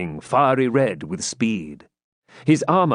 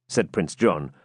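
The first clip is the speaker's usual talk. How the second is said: ordinary delivery, nothing marked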